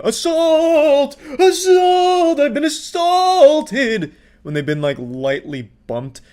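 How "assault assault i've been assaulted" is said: very high, loud, stretched-out wail; mock scream. Commentator imitating people lightly bumped, exaggerated screaming. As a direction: screech it like a hammed-up victim. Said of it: "the people that just scream".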